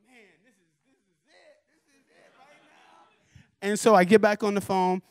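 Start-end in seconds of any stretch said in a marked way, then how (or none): 0.1-3.7 breathy near-whisper of awe; awed hush. Retold or imitated. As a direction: hush it like a secret thrill. Awed by own track playing.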